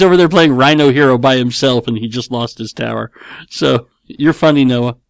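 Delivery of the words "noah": ordinary talk, nothing marked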